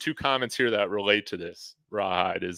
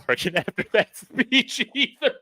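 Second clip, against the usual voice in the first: laughing; 12 semitones higher than usual. Real, not acted. Fan noting the team's failure after a pep talk, laughing. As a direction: pitch climbing high into laughter. Laughs through end of complaint.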